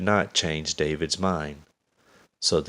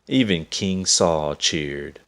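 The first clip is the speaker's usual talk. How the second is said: ordinary delivery, nothing marked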